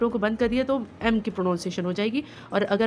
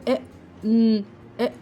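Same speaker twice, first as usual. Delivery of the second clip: put-on choppy child reading; much slower than usual. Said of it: "blend नहीं कर पाते थे".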